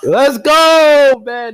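high, loud burst of real joy; joyful yell. Real, not acted. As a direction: let it rip the moment the pick lands. Pick just announced, fan erupts.

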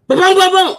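mouthed gunshots, high and loud; loud mock gunfire sounds; gunshot noises. Acting out the shooting for viewers, loud boom sounds.

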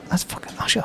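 urgent whisper; imitated hushed aside. Co-worker spotting [name] in the shop, retold whisper. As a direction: whisper it so the celebrity won't hear. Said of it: "and [name]'s like".

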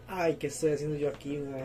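low, soft self-talk; quiet retold regret; retold muttered aside. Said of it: "mae, y hago yo".

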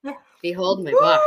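high, laughing grand unveiling; behold, presented with a giggle; excited reveal. Real, not acted.

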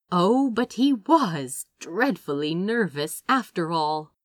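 ordinary delivery

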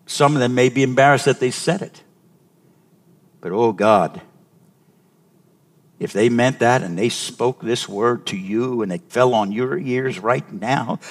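ordinary talk

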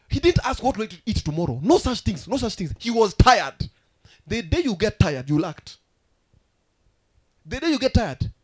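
loud preacher's declamation, pitch lifted; full-force preaching. Acted. Preacher telling church you act when tired, loud preaching. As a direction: drive each phrase out to the congregation.

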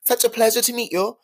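high, sugary posh greeting; classy Naomi impression. Naomi Campbell charming starstruck fans, high and sugary. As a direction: switch on the gracious supermodel charm. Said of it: "turned on the classiest smile and wave".

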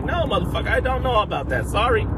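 high, light mock-apologetic voice; answering a quizzing superfan in a high mock-sorry voice; mock innocent reply. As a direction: pitch it up like a sheepish kid. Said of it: "I'm like".

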